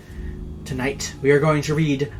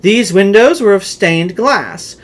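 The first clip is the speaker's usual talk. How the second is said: louder, theatrical reading of a gothic tale; dramatic story narration; 3 semitones higher, 4 dB louder than usual. A narrator's reading. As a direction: tell it like a ghost story by candlelight. Reads the Poe tale aloud.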